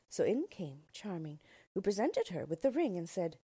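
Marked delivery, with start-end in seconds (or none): none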